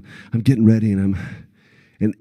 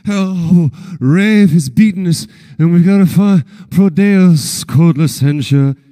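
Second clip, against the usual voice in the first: raised, exasperated groan at himself; frustrated; 6 semitones higher, slower than usual. Retold or imitated. Actor frustrated at his own fumbled take, exasperated. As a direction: vent the frustration at your own flubbed line. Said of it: "I'm so frustrated".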